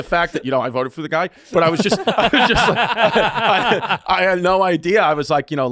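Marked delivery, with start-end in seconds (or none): 1.5-5.0 loud, high, words broken by laughs; helpless laughter. Real, not acted. Telling friends his embarrassing story, helplessly laughing. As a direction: try to speak while cracking up.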